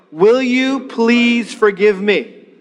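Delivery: very slow, each word spaced; slow model line. Shown as a demonstration. Pastor leading repeat-after-me, very slow. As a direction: lead the room through it. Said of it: "Here we go".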